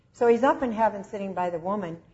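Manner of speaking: usual speaking voice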